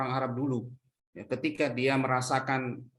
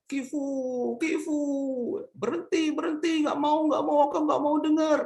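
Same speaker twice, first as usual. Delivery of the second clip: screaming in protest; 12 semitones higher than usual. Acted. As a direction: scream it like a terrified girl begging it to stop. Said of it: "perempuan tuh berteriak". (teriakan panik bernada tinggi)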